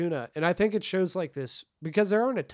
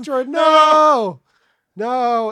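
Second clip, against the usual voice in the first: shout; 9 semitones higher, 12 dB louder, much slower than usual. Real, not acted. Reacting to droid scene.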